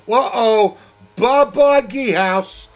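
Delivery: sudden high, loud crash call; excited shout. Real, not acted. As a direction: yell it as the car spins off. Crash seen during live commentary.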